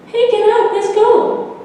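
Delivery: put-on a kid nudging a sleepy friend; eager child voice. Sammy waking Mikey to sneak out, high and eager.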